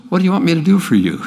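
quick, incredulous ask; almost laughable question. Shown as a demonstration. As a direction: toss it off as if the answer is obvious. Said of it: "It's almost laughable".

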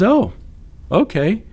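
put-on high, innocent little-kid reply; agreeable child. Voicing his young daughter's reply.

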